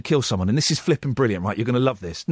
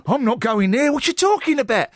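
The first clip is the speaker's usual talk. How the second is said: put-on higher-pitched, protesting little boy; storybook boy; 5 semitones higher than usual. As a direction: play a cheeky boy refusing. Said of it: "And then the boy said".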